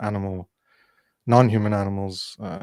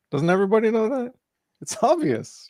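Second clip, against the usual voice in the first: sarcastic; 9 semitones higher than usual. Pretend. Sarcastic mock of meat-eaters.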